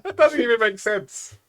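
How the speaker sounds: high, slow, laughing words. Real, not acted. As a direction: stretch it out while laughing. Still laughing.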